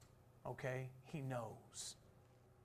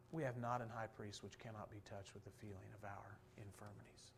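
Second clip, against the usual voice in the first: softer and lower, savoring each word; hushed rereading; 5 semitones lower, 9 dB quieter than usual. Shown as a demonstration. Preacher rereading the verse to move the church, hushed. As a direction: let the verse sink in quietly. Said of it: "Oh, isn't that good?".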